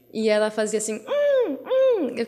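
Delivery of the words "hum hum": put-on high whimpering baby hum; imitating a fussy baby, much higher. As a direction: mimic a baby fussing to go outside. Baby whining and pointing outside.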